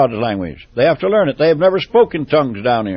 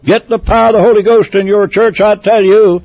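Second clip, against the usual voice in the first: higher and louder rising preacher's cry; fervent climax of a sermon; preaching at full force; 4 semitones higher, 5 dB louder than usual. Acted. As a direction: call the churches out with fire. Fiery appeal to the congregation.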